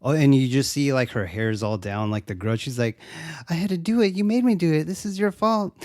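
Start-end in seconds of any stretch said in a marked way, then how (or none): 3.5-5.7 high-pitched imitation of distraught blaming; mock desperate. Woman blaming her boyfriend after killing, mock distraught. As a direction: act out a desperate excuse, pitched up.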